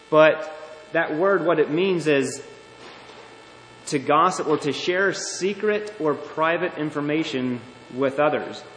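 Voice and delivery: ordinary talk, nothing marked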